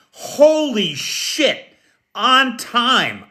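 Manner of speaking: drawn out, high-pitched disbelief; amazed exclamation. Real, not acted. Host amazed the first viewer came on time, drawn-out surprise.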